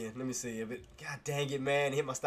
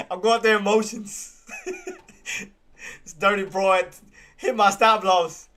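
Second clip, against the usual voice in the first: loud, higher, slow with laughs between; laughing rant; 7 semitones higher, 9 dB louder, slower than usual. Real, not acted. Trader cursing the stock to viewers, laughing and loud.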